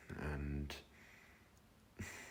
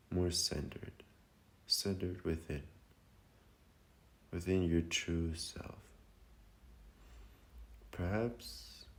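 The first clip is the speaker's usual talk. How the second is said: guided meditation. Acted. Meditation leader guiding listeners to feel lighter and centered, calm and unhurried. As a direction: lead them gently inward, phrase by phrase.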